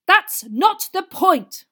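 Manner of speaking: slow, high, furious shout; rage, dragging out each word; raging. A narrator's reading. Father overrules the boys' excuse, raging. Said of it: "raged Mister Weasley".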